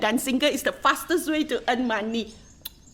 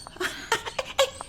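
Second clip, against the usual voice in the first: giggle; 10 semitones higher, 6 dB quieter than usual. Acted. Gossiping about a dancing girl.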